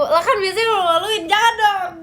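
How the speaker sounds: loud laughing, fast protest. Real, not acted.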